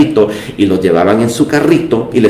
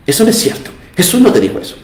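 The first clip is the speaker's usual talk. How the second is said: mock naysayer in a much higher voice; detractor imitado; 9 semitones higher, much faster than usual. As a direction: mimic someone contradicting her, pitched way up. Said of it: "una persona le diga a otra persona". (imitación burlona con voz muy aguda)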